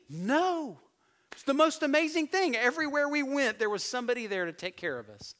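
high, loud amazed reply. Retold or imitated. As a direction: gush like you can hardly believe it. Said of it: "they were probably a little bit amazed".

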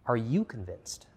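slowed, probing question with weight on you; slow searching question. Real, not acted. Preacher slowly asking the congregation to search themselves. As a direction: ask it slowly, letting it sink in.

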